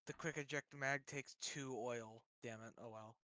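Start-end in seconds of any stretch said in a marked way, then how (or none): none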